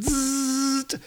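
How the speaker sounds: high buzzing zap noise; drawn-out electric zzzt; imitated zap. Shown as a demonstration. Reader imitating a giant ray blast, high buzz. Imitates comic sound effect.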